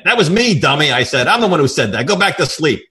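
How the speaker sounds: loud, high snap at a dummy; hammed-up impatient boss; exasperated scolding. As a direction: tell him off, fed up. Scolding dim secretary.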